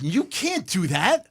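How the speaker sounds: mock telling-off; retold scolding. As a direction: imitate a brother laying down the rules. Brother scolding him, retold.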